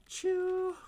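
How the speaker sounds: high, loud smooching sound; kiss sound. Pretend. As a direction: blow a big cartoon kiss. Playful kiss noises.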